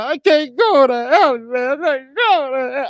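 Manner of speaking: high, strained wailing plea; mock crying plea. Breaking down before the gunmen, high wailing crying plea. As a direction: let the sobbing plea out. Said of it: "I'm about crying my ass off".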